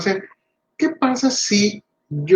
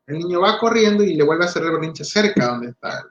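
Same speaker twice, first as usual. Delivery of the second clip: ordinary talk, nothing marked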